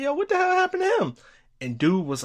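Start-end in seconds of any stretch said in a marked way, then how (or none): 0.0-1.1 high, fast, incredulous yelp; quick astonished squeak of a question; startled question. Retold or imitated. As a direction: fire it off in disbelief, way up high. Asking about the furious man.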